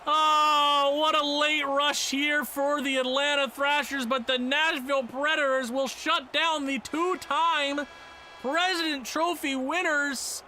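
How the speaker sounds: hammed-up announcer wrap-up; mock play-by-play. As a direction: announce the loss like TV commentary. Mock sportscaster call of the result.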